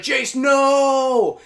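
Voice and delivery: high, loud cry of "no"; anguished shout. Retold or imitated. Dom shouting as Jace falls into the hole. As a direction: yell it as your friend drops away.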